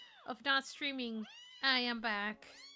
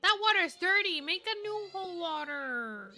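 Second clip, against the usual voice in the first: raised, higher-pitched demand; bossy calling out; 6 semitones higher, 5 dB louder than usual. Pretend. Viewer orders fresh water for kitten, calling out. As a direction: call it out, stretching the final word.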